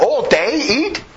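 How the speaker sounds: slow, rising disbelief; slow mock outrage at the idea; mock incredulous. Real, not acted.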